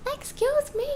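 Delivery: high offended excuse me; indignant excuse me. Real, not acted. Protesting at the man mowing outside, high and affronted. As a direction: call out, affronted. Calling out to mower.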